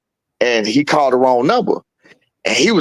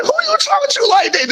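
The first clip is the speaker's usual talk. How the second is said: very high, startled scold; mock astonished father; shocked scolding. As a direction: scold him in disbelief. Dad scolding son's tone.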